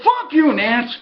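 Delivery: playful curse, not mad; friendly ribbing, not angry; joking curse. Retold or imitated. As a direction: toss it off like a friendly jab. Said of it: "he didn't say it, you know, like, mad".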